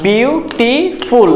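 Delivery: বিউটিফুল very slowly in three parted syllables. Shown as a demonstration. Teacher contrasting বিউটিফুল with one-syllable words, slowly syllable by syllable. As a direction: break বিউটিফুল into three long, separate pieces. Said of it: "তার মানে হচ্ছে তিনবারে".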